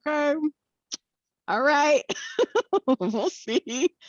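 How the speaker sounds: high, laughing doubtful "we'll see"; amused, knowing disbelief; skeptical amusement. Retold or imitated. Skeptical reply to partner.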